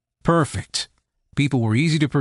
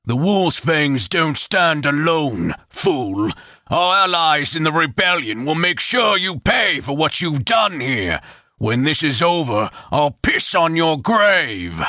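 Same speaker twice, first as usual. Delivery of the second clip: slow, hateful bandit voice; bloodied chieftain vowing vengeance; chieftain's threat; 3 semitones higher than usual. A narrator's reading. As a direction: threaten revenge from the snare.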